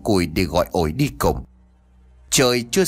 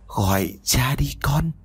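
eerie coaxing; 3 semitones higher than usual. Acted. Ghost offers bloody baby.

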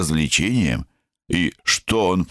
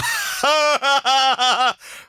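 put-on drunken laugh. A narrator's reading. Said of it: "drunk laugh".

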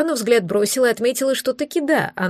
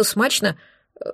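ordinary delivery

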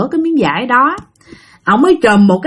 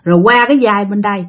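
plain reading voice

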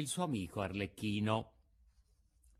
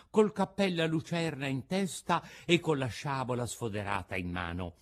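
usual speaking voice

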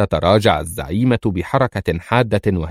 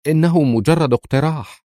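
a touch of flustered hesitation; lightly voiced embarrassed suggestion; 6 semitones higher than usual. A narrator's reading. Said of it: "وهي تقول مرتبكة". (بارتباك خفيف)

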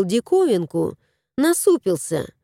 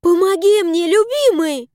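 high, louder plea; calling for help; 8 semitones higher, 4 dB louder than usual. A narrator's reading. Tanya calling husband to help with the chest, high. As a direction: call him over to help haul.

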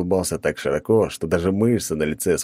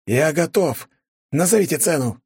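blurted out; 10 semitones higher than usual. A narrator's reading. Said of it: "выпалил князь".

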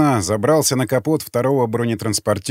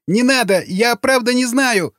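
squealing plea; 10 semitones higher than usual. A narrator's reading. Prisoner with knife at ear, squealing plea. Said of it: "завизжал боевик".

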